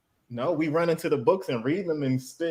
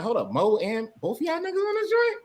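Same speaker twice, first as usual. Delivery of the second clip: disbelieving. Retold or imitated. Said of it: "and I'm like".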